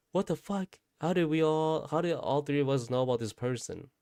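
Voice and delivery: high-pitched incredulous questioning; retold bewilderment. Three relatives realising they shared a dream, acted bewilderment. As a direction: act out baffled shock, starting very high.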